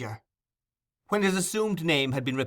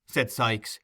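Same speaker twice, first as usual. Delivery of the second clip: usual speaking voice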